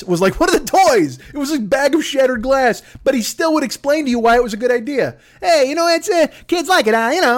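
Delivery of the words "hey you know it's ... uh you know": Mainway again. Retold or imitated. Comic voicing the toy seller defending glass toys, high brash. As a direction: shrug it off like a cheap salesman.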